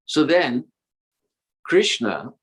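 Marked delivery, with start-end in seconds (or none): none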